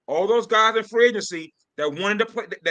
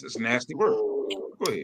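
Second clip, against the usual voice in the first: ordinary talk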